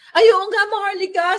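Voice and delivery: a fan going oh right, yes; surprised realization. Retold or imitated. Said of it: "Sabi niya".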